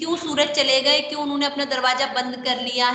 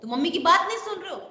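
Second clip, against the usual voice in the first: scolding elder; 7 semitones higher, much faster than usual. Retold or imitated. An elder scolds a child who ignored mummy, mock scolding. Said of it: "कोई बड़ा आके आपको डांटता है ना".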